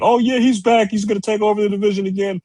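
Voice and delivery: high, excited fanboy voice; mocking fanboys. Cohost mocks Wilder fanboys returning. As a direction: squeal like a giddy fan. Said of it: "they think".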